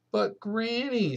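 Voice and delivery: put-on high, small child's plea; a little boy protesting to granny; small boy pleading. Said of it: "said Patrick".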